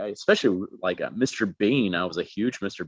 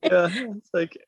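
normal talking voice

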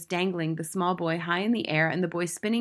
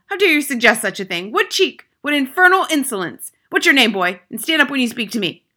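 high, loud indignation; outraged headmistress tirade; Trunchbull shouting; 7 semitones higher, 11 dB louder than usual. A narrator's reading. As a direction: explode at an insolent pupil. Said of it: "shouted the Trunchbull".

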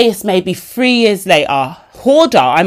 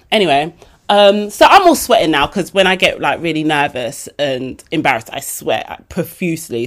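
ordinary delivery

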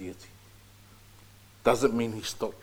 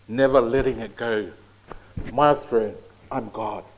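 loud, proud voice of a selfish god; booming self-centered god; 6 dB louder than usual. Pretend. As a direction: boom it out like a vain deity. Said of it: "Imagine myself being God".

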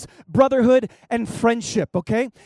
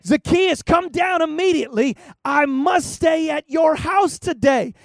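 calling out; 4 semitones higher, 3 dB louder than usual. Retold or imitated. Jesus calling Zacchaeus down.

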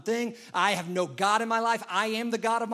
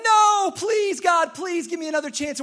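much higher and louder, begging for mercy; desperate pleading shout; 10 semitones higher, 8 dB louder than usual. Retold or imitated. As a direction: shout for one more chance in panic. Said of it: "And then we're like".

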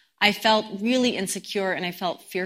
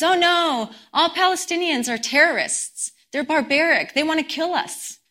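high-pitched mock alarm; retold alarm; 7 semitones higher than usual. Sister warning her brother about Palestinians, in retold alarm. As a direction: imitate someone warning of danger, lightly acted.

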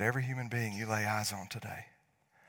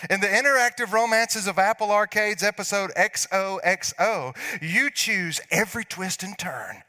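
mock ad read; 9 semitones higher, 8 dB louder than usual. Reading an app ad, hammed up.

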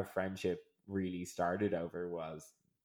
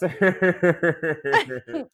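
slow laughing words; 8 semitones higher, 9 dB louder than usual. Real, not acted. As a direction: laugh while speaking. Laughing at friend.

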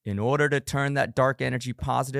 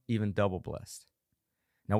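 normal talking voice

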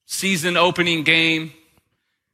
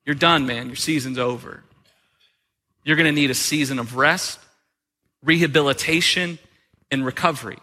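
calm trainer; 7 semitones lower than usual. Retold or imitated. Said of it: "You know what they said?".

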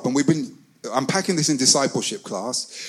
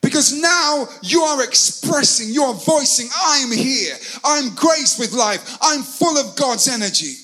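impassioned preaching; 8 semitones higher than usual. Real, not acted. Preacher urging congregation to make a joyful noise, impassioned. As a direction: preach it high and stretch the key words.

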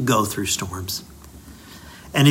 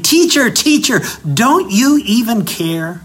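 high, loud desperate cry of Teacher; panicked shouting; 8 semitones higher, 4 dB louder than usual. Retold or imitated. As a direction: shout it in panic, much higher. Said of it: "they wake Jesus up shouting".

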